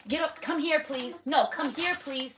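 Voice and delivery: stern mother voice that wants it done now; firm calling. Real, not acted. Mom calls child over firmly.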